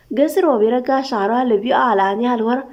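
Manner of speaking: ordinary delivery, nothing marked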